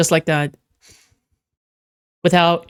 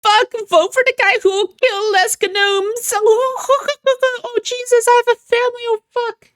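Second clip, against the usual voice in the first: very high squeaky little gnome voice; hammed-up frantic gnome, pitched way up; panicked gnome. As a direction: plead for your life in a tiny high voice. Acting out gnome in meme.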